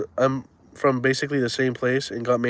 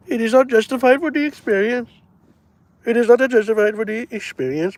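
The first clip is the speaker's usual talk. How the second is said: mock high voice; 10 semitones higher than usual. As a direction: squeak it out as a parody. Mock voice repeating the line.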